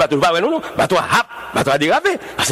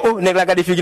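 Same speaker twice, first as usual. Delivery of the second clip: usual speaking voice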